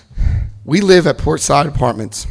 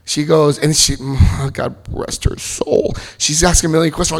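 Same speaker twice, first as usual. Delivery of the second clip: ordinary talk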